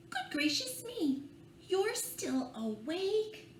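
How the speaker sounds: mock surprise. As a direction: gasp at the wide-awake snake. Mock surprise at awake snake.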